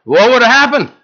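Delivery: rising to a loud, high call; old preacher's challenge. Retold or imitated. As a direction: belt it like an old-time preacher. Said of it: "he said".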